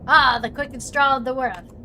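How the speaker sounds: lightly voiced cowboy hoot; cowboy accent. Character joking about quick draw, in a light mock cowboy accent.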